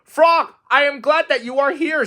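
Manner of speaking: high, loud and breathless; thrilled on the speeding sled; excited Toad. A narrator's reading. Toad rushing down the hill, high and excited.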